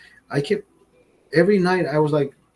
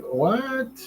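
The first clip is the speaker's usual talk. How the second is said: incredulous; 10 semitones higher, 5 dB quieter than usual. Retold or imitated.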